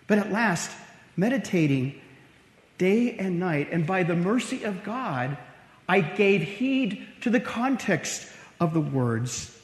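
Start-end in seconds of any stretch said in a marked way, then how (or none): none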